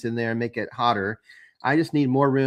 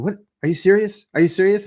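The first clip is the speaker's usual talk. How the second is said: retold indignant outburst, higher and louder; higher, louder mock outrage; mock mad; 7 semitones higher, 4 dB louder than usual. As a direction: imitate someone mad and incredulous. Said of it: "Kyle got all mad".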